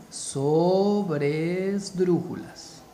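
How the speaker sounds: sobresdrújulas drawn out very slowly; slow repeat. Shown as a demonstration. Teacher repeating a new term, very slow and drawn out. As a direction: stretch each syllable of sobresdrújulas. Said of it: "Repito, se llaman".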